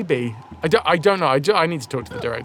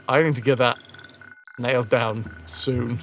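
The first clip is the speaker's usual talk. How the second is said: normal talking voice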